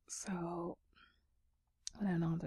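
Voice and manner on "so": sleepy late-night whisper. Real, not acted. ASMR whisper throughout.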